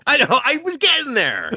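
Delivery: loud, high-pitched defensive outburst; exasperated protest. Real, not acted. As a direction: protest being cut off, voice shooting up. Interrupted, protests to co-host.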